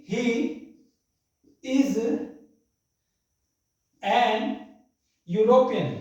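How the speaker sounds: one word at a time, long pauses; separated words with European landing firmly; slow dictation. Shown as a demonstration. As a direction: dictate as you write it out. Dictates while writing on board.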